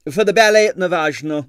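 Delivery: high, loud foreign impresario; excitable impresario voice. A narrator's reading. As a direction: sweep it out grandly. Stroganoff voiced.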